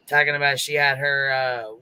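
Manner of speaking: usual speaking voice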